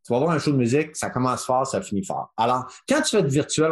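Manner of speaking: ordinary talk, nothing marked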